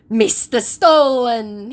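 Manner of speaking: mock scammer taunt. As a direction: drawl it as him. Voicing scammer.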